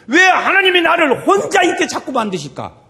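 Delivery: high, loud cry of why; impassioned questioning. Acted. Preacher voices a lonely believer questioning God, impassioned loud cry.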